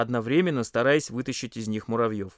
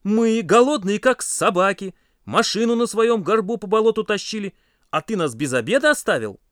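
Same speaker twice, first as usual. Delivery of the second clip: loud, raised, indignant reproach; angry accusation; 9 semitones higher, 6 dB louder than usual. A narrator's reading. Hungry friends round on the cook, angrily accusing. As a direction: press hard with high, forceful accusation. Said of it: "наседали на него ребята".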